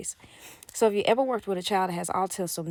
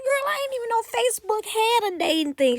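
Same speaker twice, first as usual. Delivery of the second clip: much higher, squealed disbelief; amazed; 4 dB louder than usual. Real, not acted. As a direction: gasp it out in disbelief. Shocked reaction to Facebook dating.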